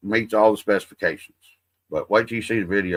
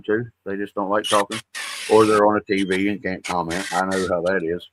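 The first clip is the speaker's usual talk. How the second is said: normal talking voice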